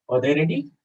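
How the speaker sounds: "Are they ready?" climbing through "ready"; question intonation. Shown as a demonstration. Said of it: "change the intonation a little bit".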